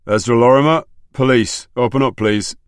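loud police call at a door. A narrator's reading.

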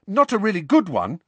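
put-on high, anxious-to-please boy; hasty reassurance. A narrator's reading. Son softening his request to mother, hastily. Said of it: "Ron hastened to add".

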